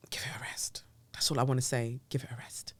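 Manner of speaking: hushed, breathy whisper of the same phrase. Acted. Vlogger whispering to viewers to give it a rest. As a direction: whisper it like a secret aside.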